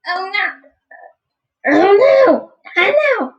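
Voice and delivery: high squeaky panicked voice; Toad. Acted. Player voices Toad reacting to bad news, high alarmed voice. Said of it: "I need a Toad voice".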